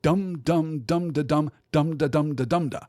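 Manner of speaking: dum syllables chanted in an uneven stress pattern; irregular beat. Shown as a demonstration. Teacher showing the meter that really comes out. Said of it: "what we actually get is".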